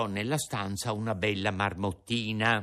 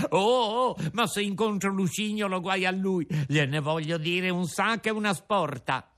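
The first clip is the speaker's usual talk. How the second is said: high, loud puppet anger; indignant threat; 7 semitones higher, 3 dB louder than usual. A narrator's reading. Pinocchio swears to give Lucignolo a piece of his mind, angry. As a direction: vow revenge on Lucignolo.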